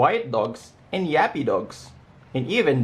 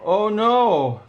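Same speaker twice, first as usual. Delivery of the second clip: a sudden high "Oh no" of alarm; dismayed cry; 8 semitones higher, 3 dB louder than usual. A narrator's reading. Shelter director sees the escaped puppies, alarmed cry. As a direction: cry out as the chaos hits. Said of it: "cried the director".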